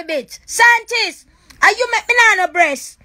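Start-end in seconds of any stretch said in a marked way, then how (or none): 0.0-0.4 a scornful aside tossed off under the breath; muttered insult. Real, not acted. Woman on live mutters an insult at the scientist. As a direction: drop the voice to a low muttered insult.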